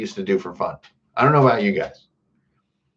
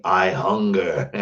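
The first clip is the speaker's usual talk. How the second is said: slow, low monster declaration; Sinistar; 4 semitones lower, slower than usual. Acted. Streamer quoting arcade villain, slow and low. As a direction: intone it slowly like a hungry game villain. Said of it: "was Sinistar".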